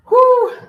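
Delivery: big loud blowing exhale; loud relieved whew. Real, not acted. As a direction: blow it out hard. Blowing out after the curls.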